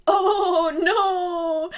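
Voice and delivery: high, loud mock wail of dread, laughing; half-laughing cry of horror. Real, not acted. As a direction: wail at the thought of a big spider. Dreading big spiders.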